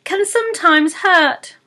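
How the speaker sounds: high, fast pixie voice; quick pixie blurt. Acted. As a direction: rush the warning out. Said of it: "in a rather quick blurt".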